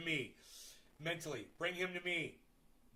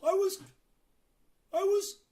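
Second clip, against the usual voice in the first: higher, louder excited cry; gasp and shout; 9 dB louder, much slower than usual. Real, not acted. Streamer shouts in amazement at what he just saw. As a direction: yell in amazed disbelief. Sees the dog's stunt, excited.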